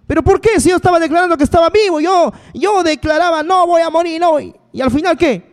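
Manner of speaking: mock protest. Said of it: "Le dices".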